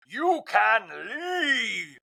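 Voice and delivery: high, very drawn-out imitation; Meredith Marks. Replaying an iconic dismissal, exaggerated high drawl. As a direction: stretch each word long and high. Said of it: "a flashback to the iconic".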